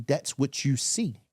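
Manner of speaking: ordinary talk, nothing marked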